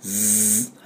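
soft long buzz like bee wings; buzzing z sound. Shown as a demonstration. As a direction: hum a quiet bee buzz. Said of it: "蜂の音になるんです".

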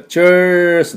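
slow, held "チェース"; long ir sound. Shown as a demonstration. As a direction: stretch the r-coloured vowel of "チェース". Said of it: "アイアールですから、".

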